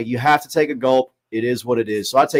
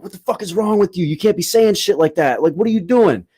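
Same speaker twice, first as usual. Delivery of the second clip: high-pitched, indignant scolding; retold outburst, voice pitched up in disbelief; outraged confrontation; 6 semitones higher than usual. Comic telling his girlfriend off after her remark, retold outrage.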